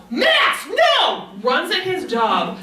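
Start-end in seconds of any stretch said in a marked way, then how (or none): 0.1-1.3 very high, alarmed mock yell; imitated yell. Man seeing what his dog is doing, high mock yell.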